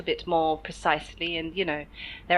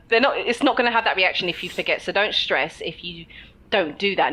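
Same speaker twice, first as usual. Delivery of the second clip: ordinary delivery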